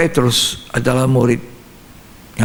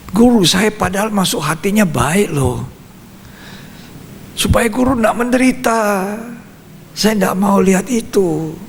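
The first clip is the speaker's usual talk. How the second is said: high-pitched mock indignation; acted hurt protest; 6 semitones higher than usual. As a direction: protest plaintively in a raised pitch, as an imagined Peter. Imagined Peter protesting being rebuked. (pura-pura protes tersinggung)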